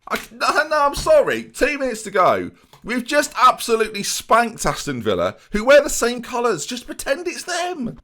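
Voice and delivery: high, loud frustrated protest; exasperated outburst. Real, not acted. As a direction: let the disbelief burst out high. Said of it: "And I'm just livid".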